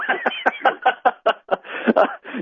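airy, unvoiced laughter; quiet airy chuckle; breathy laugh. Real, not acted. Laughs at Roger.

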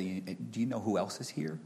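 soft, low fatherly reassurance; soft comfort to a frightened four-year-old; comforting his son. Retold or imitated. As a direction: hush a scared child on your lap. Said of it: "I looked at him and said".

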